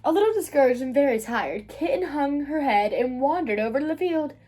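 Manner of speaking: usual speaking voice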